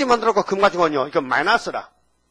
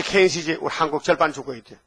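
ordinary talk, nothing marked

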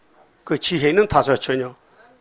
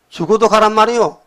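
ordinary talk

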